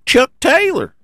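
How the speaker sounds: squeaky high whiny complainer voice; mock angry fan. Mocking a fan emailing about his driver, high whiny rant. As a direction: mimic a ranting fan way up high. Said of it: "emails saying".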